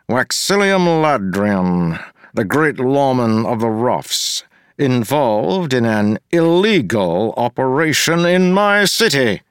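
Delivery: a pompous official looking down on everyone; smug mayor. Acted. Mayor Gave demanding an apology from Wax, sneering.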